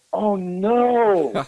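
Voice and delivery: high, loud dismayed cry; mock panic. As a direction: yelp it out in sudden panic. Realizing no ballad was written.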